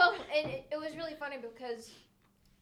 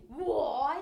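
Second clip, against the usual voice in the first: surprised; 4 semitones higher, 6 dB louder than usual. Retold or imitated. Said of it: "I was like".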